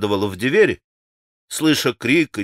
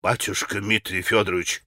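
low, soft deferential appeal; lightly voiced innkeeper, lower and softer; proclaiming innkeeper; 6 semitones lower, 7 dB quieter than usual. A narrator's reading. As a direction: address the master humbly, keeping it low. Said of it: "возгласил Трифон Борисович".

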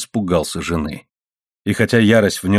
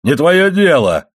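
loud, higher, drawn-out retort; raging; 6 semitones higher, 5 dB louder, slower than usual. A narrator's reading. Husband raging at his wife, furious. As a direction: storm back, stretching the words. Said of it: "бушевал Моррелл".

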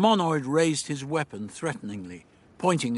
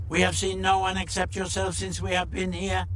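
rigid, mechanical demand; Monoid interrogator; 7 semitones higher than usual. Acted. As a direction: question like a droning alien. Monoid questions the Doctor.

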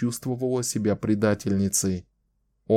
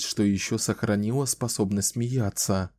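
ordinary delivery, nothing marked

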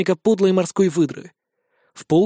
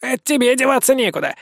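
higher, smirking vampire voice; 6 semitones higher than usual. A narrator's reading. Vampire smirks back at the magister, higher and cocky. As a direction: grin through it like a cocky vampire. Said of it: "ухмыльнулся упырь".

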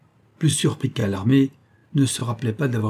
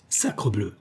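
irritated growl; 4 dB quieter than usual. A narrator's reading. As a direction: grumble a mild oath under your irritation. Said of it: "gronda Charles énervé".

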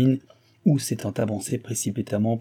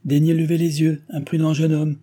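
high, loud and cold with scorn; mother rebuking her son with haughty anger; 6 semitones higher, 5 dB louder than usual. Acted. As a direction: look down on him and scold. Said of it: "avec hauteur et colère".

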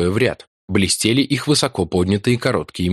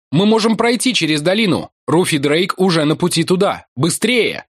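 shouting; 9 semitones higher than usual. A narrator's reading. Shouting up to Martin.

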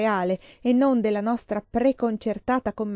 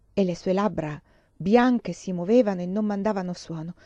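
ordinary delivery, nothing marked

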